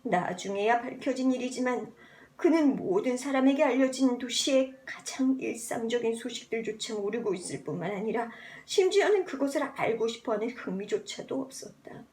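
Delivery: ordinary delivery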